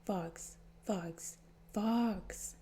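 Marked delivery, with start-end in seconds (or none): 0.0-1.4 soft urgent whisper. Acted. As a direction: hiss his name under your breath. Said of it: "crowded him and whispered".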